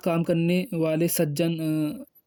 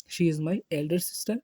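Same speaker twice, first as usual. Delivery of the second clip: slow, even model sentence; slow demo; 3 dB quieter, much slower than usual. Shown as a demonstration. Teacher modelling how to introduce an elder sister, slow. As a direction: say it slowly and clearly. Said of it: "इसी तरीके से ये मेरी दीदी हैं".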